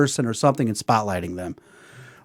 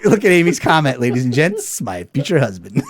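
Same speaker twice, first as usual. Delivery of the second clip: normal talking voice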